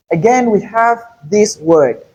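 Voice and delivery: normal talking voice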